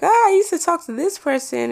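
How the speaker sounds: high-pitched acted exclamation; mock squeal. Host looking back on old friends, mock squealing.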